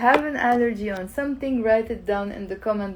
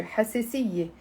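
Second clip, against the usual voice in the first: حساسية run together in one quick word; faster, natural flow; 5 dB quieter, faster than usual. Shown as a demonstration. Teacher showing how it's said live, faster. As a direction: say حساسية smoothly, no gaps between syllables. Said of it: "You're gonna say it faster".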